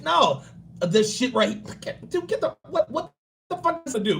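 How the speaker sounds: high, whiny mock of a primping dude; mock-fussy high voice; fussy guy impression. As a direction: play the overfussy guy, fretting. Mocking an overly fussy guy.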